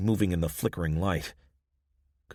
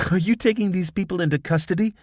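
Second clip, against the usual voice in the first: giggling; 12 semitones higher, 6 dB louder, faster than usual. A narrator's reading. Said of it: "She giggled to her comrade".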